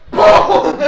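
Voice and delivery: very high, very loud boom. Acted. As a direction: blast the impact sound. Narrating punch.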